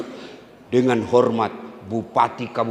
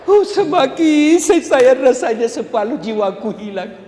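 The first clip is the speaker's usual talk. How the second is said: mock lament; 12 semitones higher, 5 dB louder than usual. Husband's lament acted for laughs.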